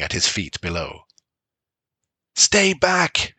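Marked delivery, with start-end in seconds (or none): 2.3-3.3 raised high shout. A narrator's reading. Father shouting at his children in the storm, high. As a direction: yell over the storm at the kids. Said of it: "shouted Bran".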